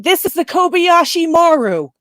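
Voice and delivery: high, loud film-line imitation; distressed ship's caller. Fan quoting guy on the ship seeking help, high urgent call. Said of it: "except for the guy saying".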